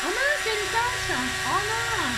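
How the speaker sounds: high, loud wail of dismay; real dismay. Real, not acted. Crafter spots a stain on the sheet, wailing in dismay. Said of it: "je suis dégoûtée".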